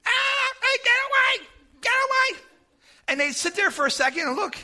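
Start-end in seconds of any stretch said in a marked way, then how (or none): 0.0-2.4 high yelled warning; screaming. Retold or imitated. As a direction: yell at the onlookers to get away. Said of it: "And I'm screaming".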